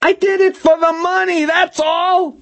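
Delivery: very high, frantic confession; panicked high-pitched yell; Milosevic screaming. A narrator's reading. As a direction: scream it as a desperate excuse. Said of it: "Milosevic screamed".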